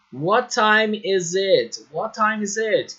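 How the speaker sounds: What time is it drawn out, each word clear; slow model question. Shown as a demonstration. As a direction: teach the question slowly. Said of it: "a frase que deve ser usada". (pergunta modelo lenta e clara)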